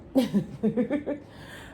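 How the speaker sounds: high rising laughter; real laugh. Real, not acted. Admitting she has a lot of pet peeves, high laugh.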